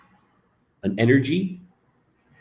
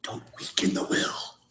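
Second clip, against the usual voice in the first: whispered warning; 8 dB quieter than usual. Shown as a demonstration. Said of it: "whispered injunction".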